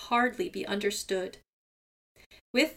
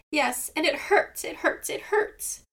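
high, breathy pained whine fading to a whisper; whining; 6 semitones higher than usual. A narrator's reading. Seagull whining to Raven about his foot, high pained whine. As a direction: whimper it, out of strength. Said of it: "whined Seagull".

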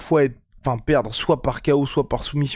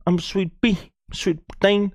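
upbeat ad read, a bit higher; sponsor shout-out; 6 semitones higher than usual. Acted. Host plugging his sponsor to listeners, upbeat ad read. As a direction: pitch the promo code like an ad.

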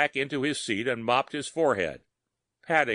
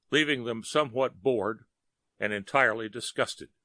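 ordinary delivery, nothing marked

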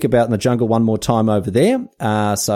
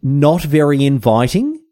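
higher, slower, solemn reading of the diary; reading Darwin's diary; 4 semitones higher, 3 dB louder, slower than usual. Acted. Host reads Darwin's diary aloud to listeners, in a mock solemn higher voice. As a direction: intone Darwin's words with mock gravity. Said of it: "here we go".

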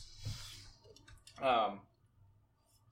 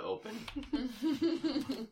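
slow, high, laughing; 13 semitones higher than usual. Real, not acted. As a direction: giggle through it slowly. Joking about the data pad.

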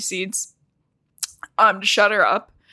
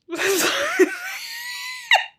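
high, shouting laugh through tears. Real, not acted. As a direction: burst out laughing while crying. Laughing while nearly crying.

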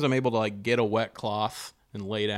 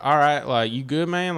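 loud, overdone Southern check-in; exaggerated Southern accent; 4 semitones higher, 4 dB louder than usual. Comic checks on his fainted friend, thick Southern accent. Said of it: "he's not this Southern".

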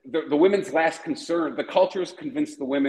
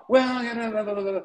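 higher, mocking yap of a woman talking back; higher mocking blah blah of a nagging woman; mocking woman's voice; 7 semitones higher, faster than usual. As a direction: parody her droning on and on.

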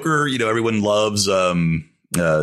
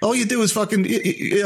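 mock Bale rant; 12 semitones higher, faster than usual. Imitating Bale's rant.

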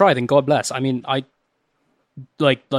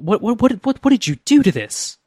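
high, stammering dismay; higher-pitched, aghast questioning; appalled disbelief; 7 semitones higher than usual. Acted. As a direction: sputter in acted horror at a terrible dish. Acted disgust at salty soup.

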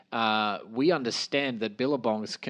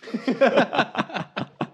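high cackling laugh; 12 semitones higher than usual. Real, not acted. Laugh in character.

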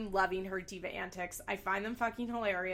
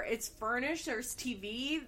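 ordinary talk

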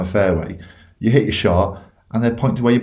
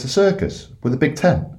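higher-pitched, doubtful check; double-check it as a baffled joke character; puzzled questioning; 7 semitones higher than usual. Acted. Duck keeps questioning the job.

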